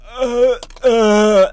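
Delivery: loud, high strangled groans; imitated struggle for words. Comic imitates himself trying to speak on stage, strangled groans. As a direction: act out words sticking in the throat. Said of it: "as I'm trying to get words out".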